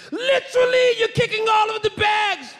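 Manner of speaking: high, shouted mock British accent; hammed-up British accent yelling; bad British accent. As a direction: yell it like a cartoon British guard. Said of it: "He's yelling at me in British".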